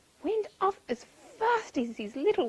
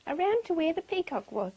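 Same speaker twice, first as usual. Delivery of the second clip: ordinary delivery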